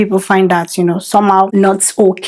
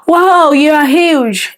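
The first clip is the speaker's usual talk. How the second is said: slow, drawn-out high "Wow, you are huge"; mocking gasp at a pregnant belly; mock rude remark; 6 semitones higher, much slower than usual. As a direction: exaggerate the shock at her size. Acting out rude comment.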